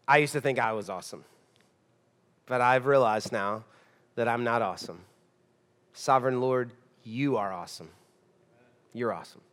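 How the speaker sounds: low, soft confession with long pauses; humbled Samson. Retold or imitated. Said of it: "Humble Samson says, God".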